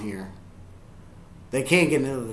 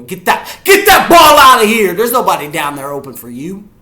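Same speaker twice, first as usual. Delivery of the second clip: high shout, easing toward the end; sudden yell; 7 semitones higher, 14 dB louder than usual. Real, not acted. Fan at the quarterback with nobody open, sudden yell. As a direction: burst out yelling at the play.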